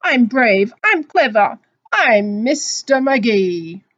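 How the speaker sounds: loud, proud declaration, drawn out on the name; Mister Magee boasting. Acted. Mister Magee singing out his readiness, loud boast. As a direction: announce yourself boldly, stretching the last words. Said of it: "sang he".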